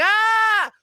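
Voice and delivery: very high panicked ah scream. Retold or imitated. Realizing the ice is too thin, a panicked scream. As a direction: yelp in sudden alarm.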